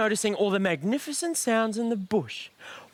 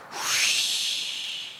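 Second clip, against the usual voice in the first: breathy wind sound; whispered whoosh. Retold or imitated. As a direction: blow it out like the breeze. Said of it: "the sound of the breeze".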